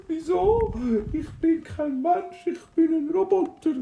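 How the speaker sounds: crying robot. Acted. Robot still crying to dwarf.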